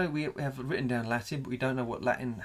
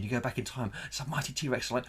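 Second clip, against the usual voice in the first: ordinary talk, nothing marked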